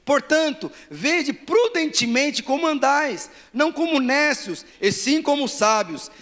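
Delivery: loud, high, slow declamation; full-force preaching. Real, not acted. Preacher urging the church to declare scripture, full-force preaching. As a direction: proclaim each phrase with rising force.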